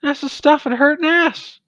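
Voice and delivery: squeaky ermine. Acted. Ermine squeaking back at the party, very high frantic squeak. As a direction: squeal it like a tiny panicked ermine. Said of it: "And they squeak back".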